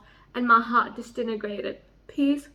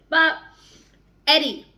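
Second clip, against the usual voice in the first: normal talking voice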